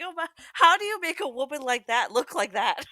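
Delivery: high, loud mock disbelief; incredulous exclamation. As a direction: gasp it out in shocked disbelief. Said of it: "I was like".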